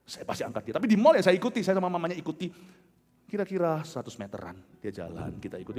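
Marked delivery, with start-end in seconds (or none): none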